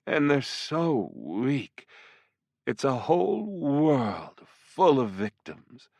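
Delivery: slow, teasing demon seductress; flirtatious demoness. Acted. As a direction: purr each threat slowly and playfully. Voices the succubus.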